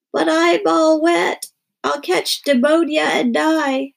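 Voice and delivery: high, loud, slow wailing complaint. Acted. Girl wailing to her father at the sink, high and slow. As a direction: drag it out in a high wail. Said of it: "She wailed".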